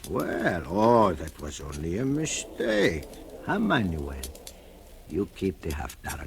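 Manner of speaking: ordinary talk, nothing marked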